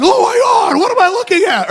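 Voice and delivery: high-pitched mock horror; comically aghast, pitch shooting up; horrified onlooker. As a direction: gasp it out as an appalled student doctor. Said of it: "student doctors are watching in horror".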